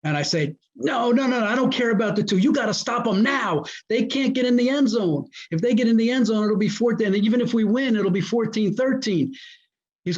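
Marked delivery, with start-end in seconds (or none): 0.8-9.3 high, urgent sideline coaching; insistent retold order. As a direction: re-enact overruling an assistant, pitched up.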